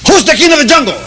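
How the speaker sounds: high, loud lion roar of a question; hammed-up nasty lion challenge. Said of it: "the lion was very nasty".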